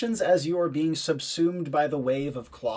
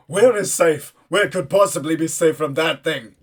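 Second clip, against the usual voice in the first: panicked militiaman; 2 semitones higher, 8 dB louder than usual. Acted. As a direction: demand an answer with all joking gone. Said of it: "all semblance of joking is, uh, completely gone".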